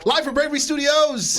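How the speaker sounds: loud, high hype announcer call; announcer shout. Acted. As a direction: yell it like a show intro.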